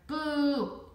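long drawn-out BOO, high and loud; shouted boo. A narrator's reading. Boy shouting to startle the classmate in front, loud drawn-out boo. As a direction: shout BOO as one stretched, booming scare. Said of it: "leaned over and shouted".

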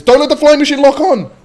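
high panicked yell; panicked shout. Real, not acted. Panicked warning mid-game.

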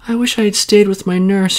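put-on high wistful child voice; a small girl missing home; high, regretful little girl; child's wish. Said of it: "she said".

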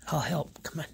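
quick unvoiced whisper. Real, not acted. Helping dog onto bed.

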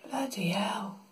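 breathless whispered curse; whispering. Real, not acted. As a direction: whisper it under your breath. Repeats exclamation in two ways.

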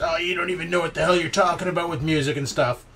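usual speaking voice

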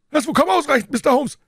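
very high, loud, screeching fury; shrieking old man. A narrator's reading. Professor shrieking hostilely at Holmes, very high and loud. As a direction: scream it like a frantic old professor. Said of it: "mit hoher, kreischender Stimme".

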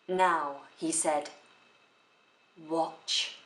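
long pause before "watch"; emphatic pause. Shown as a demonstration. Teacher showing how a pause emphasizes a word, emphatic pause. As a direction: hold back, then set "watch" apart on its own. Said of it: "pause comes either before or after a word".

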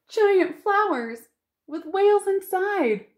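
awed delight at flowers; delighted wonder. Real, not acted. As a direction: marvel at the picture. Marvels at picture.

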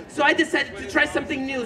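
normal talking voice

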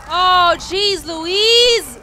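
loud, high yelp of alarm; shouted exclamation, pitched high; startled shout. Real, not acted. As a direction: cry out loudly at a sudden shock. Panicked exclamation during gameplay.

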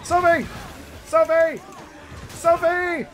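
frantic calling Sophie. Real, not acted. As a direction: shout her name across the map. Calling out to character.

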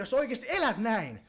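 ordinary delivery

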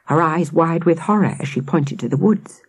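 ordinary delivery, nothing marked

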